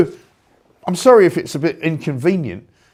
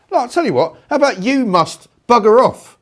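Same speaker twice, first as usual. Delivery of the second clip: higher, louder telling-off; host firing back at Burnham, raised and angry; angry retort; 8 semitones higher, 5 dB louder than usual. Real, not acted. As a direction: snap it back at him. Host tells politician off.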